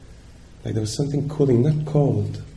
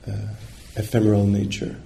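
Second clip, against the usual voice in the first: ordinary talk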